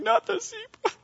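imitating a wife wailing for the zebra; dismayed ladies. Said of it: "all the ladies are like".

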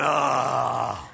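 long, low swooning sigh; swooning women. Acted. Preacher mimics women hearing a love verse, swooning. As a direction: melt into one drawn-out "ah". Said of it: "The women are going".

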